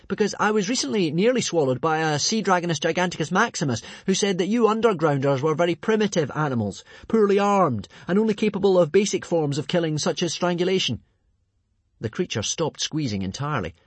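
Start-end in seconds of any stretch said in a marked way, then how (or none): 0.1-11.0 high, urgent, strained explanation; gasping for air. A narrator's reading. Hiccup bluffing the strangulator for his life, high strained voice. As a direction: talk fast-witted through a squeezed chest. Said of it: "said Hiccup, gasping for air".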